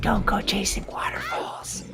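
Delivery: whispering. Acted. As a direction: whisper it playfully. Whispered joke line.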